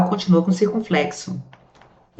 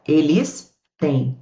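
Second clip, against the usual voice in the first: slow, deliberate example; 3 semitones lower, much slower than usual. Shown as a demonstration. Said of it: "e o plural do verbo ter".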